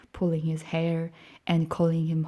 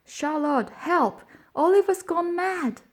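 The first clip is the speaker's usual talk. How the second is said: calling for help; 12 semitones higher, 5 dB louder than usual. Acted.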